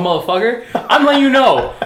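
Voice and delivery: high, loud hammed-up rant; yelling like an angry chef in the kitchen; mock fury. Real, not acted. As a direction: play the shouting chef at yourself. Mock Gordon Ramsay fury.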